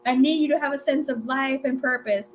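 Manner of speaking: a hint of a begging mother; imitating pleading mom. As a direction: mom begging her daughter to date him, mildly tearful. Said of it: "she starts crying".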